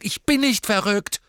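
high, loud cry of denial; angry shout. A narrator's reading. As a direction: hurl it out hurt.

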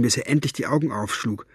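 plain reading voice

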